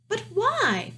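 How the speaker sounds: high-pitched princess protest. A narrator's reading. Princess challenges her father's order, protesting in a high voice. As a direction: object as the princess, pitch jumping up. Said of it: "protested the pea".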